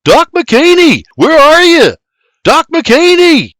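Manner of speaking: high, loud searching holler; urgent shout. A narrator's reading. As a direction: shout to rouse the whole house. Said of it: "he shouts".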